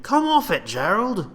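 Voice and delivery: very high, loud retort; arguing soldier. Acted. Soldier retorting to Gerald, high and exasperated. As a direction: shoot it back in exasperation.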